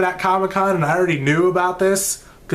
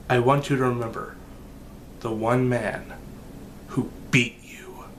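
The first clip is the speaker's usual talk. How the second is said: dramatic quote reading; 3 semitones lower than usual. Retold or imitated. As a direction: read it like a villain's threat, dropping to a hushed whisper at the end. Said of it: "the quote goes like this".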